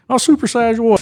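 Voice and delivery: high, loud mock outrage; indignant mom. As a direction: snap back like an offended mother. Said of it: "she'd be like".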